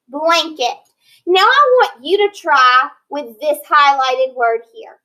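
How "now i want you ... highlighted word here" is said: usual speaking voice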